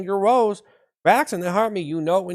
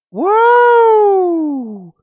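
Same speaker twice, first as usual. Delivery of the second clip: excited cheer; 13 semitones higher, 10 dB louder than usual. Acted. Hype cheer.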